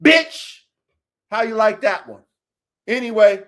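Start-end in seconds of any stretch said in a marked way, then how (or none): 0.0-0.6 high, loud, explosive retort; defiant shout. Acted. Speaker answering those who judge his cursing, defiant shout. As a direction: fire it back at the critics. Snaps back at imagined critics.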